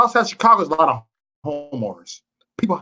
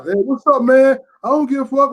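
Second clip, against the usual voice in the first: higher, louder casual street greeting; retold gangster; 9 semitones higher, 5 dB louder than usual. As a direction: toss it off like a guy who couldn't care less. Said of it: "he was just talking".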